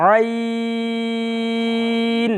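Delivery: আইন with the আ stretched very long, four to six counts; long drawn vowel. Shown as a demonstration. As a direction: hold the আ of আইন long from mid-throat. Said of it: "আরেকটু লম্বা". (আইন এর আ টেনে লম্বা করে বলা)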